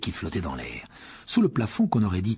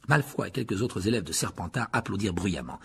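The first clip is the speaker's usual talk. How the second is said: ordinary delivery, nothing marked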